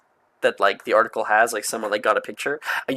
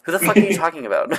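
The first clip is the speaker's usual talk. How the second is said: mock baffled; 7 semitones higher, 6 dB louder, faster than usual. Imitated baffled reply.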